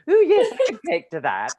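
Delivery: high, loud mock-refined accent; hammed-up mum taking to her new elocution voice; imitating mum. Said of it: "then she's like".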